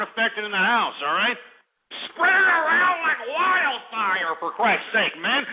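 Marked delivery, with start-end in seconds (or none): 2.1-4.3 hyped urging. Real, not acted. Urging listeners to share.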